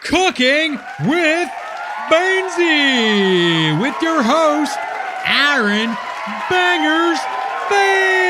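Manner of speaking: slow, high, booming game-show announcer; announcer intro. Acted. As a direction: draw out every word for the big entrance.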